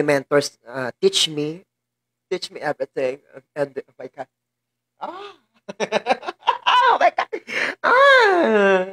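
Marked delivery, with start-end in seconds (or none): 5.0-8.9 real high sobbing cries mixed with laughter; crying wail. Real, not acted. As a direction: wail and laugh at once, pitch leaping up. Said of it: "my eyes is not stopping to cry".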